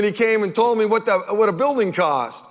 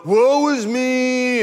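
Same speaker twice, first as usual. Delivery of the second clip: mock self-pity; 5 semitones higher, much slower than usual.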